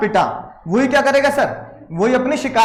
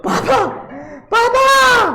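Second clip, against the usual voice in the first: high pleading wail of papa; a beaten kid calling desperately for dad; panicked child's cry; 11 semitones higher, much slower than usual. Acted. Little brother cries out while beaten.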